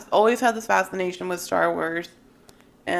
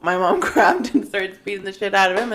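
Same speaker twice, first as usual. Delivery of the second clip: ordinary talk, nothing marked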